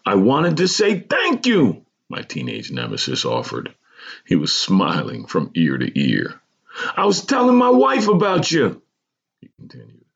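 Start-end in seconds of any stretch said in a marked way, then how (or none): 0.1-1.8 high, slow, exuberant gratitude; excited thanks. A narrator's reading. Former nemesis thanking old schoolmate, high and excited. 7.0-8.8 high-pitched, exuberant voice; excited. A narrator's reading. Man telling old schoolmate he's been praised, excited.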